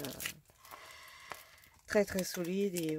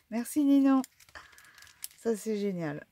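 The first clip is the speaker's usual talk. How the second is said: excited thanks; 4 semitones higher, 10 dB louder than usual. Real, not acted. Remercie son amie pour le cadeau.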